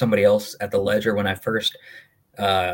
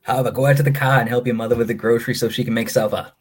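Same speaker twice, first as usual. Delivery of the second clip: put-on a parent bossing in a broad Maine accent, pitched a touch up; thick Maine accent; 3 semitones higher than usual. Parent telling kid Harbor to fetch groceries, thick Maine accent. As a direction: nag the kid in full Maine brogue. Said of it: "You guys really sound like that?".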